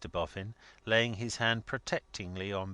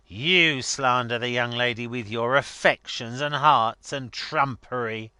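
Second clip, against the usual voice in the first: loud, emphatic scornful comeback; heated, louder counter-accusation; indignant retort; 4 semitones higher, 9 dB louder than usual. A narrator's reading. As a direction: throw the accusation back forcefully. Boffin throws the accusation back.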